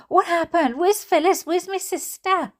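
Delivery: high, urgent frightened questions; frantic. Acted. Mother frantically asking Steve where her sister is. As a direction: demand answers in a panic.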